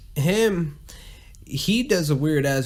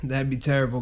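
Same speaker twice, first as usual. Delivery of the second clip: ordinary talk, nothing marked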